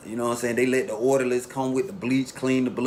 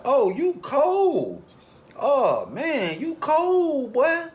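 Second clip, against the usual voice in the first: much higher, slow, drawn-out exclamation; stunned awe; 13 semitones higher, much slower than usual. Retold or imitated. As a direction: drag it out high, in stunned disbelief. Reacts to tongue cut out.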